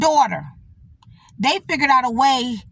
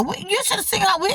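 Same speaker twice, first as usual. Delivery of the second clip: ordinary talk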